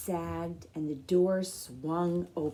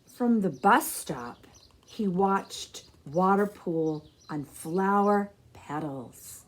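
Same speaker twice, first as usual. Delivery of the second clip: storybook narration; 4 dB louder than usual. A narrator's reading. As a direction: tell the story to a room of children.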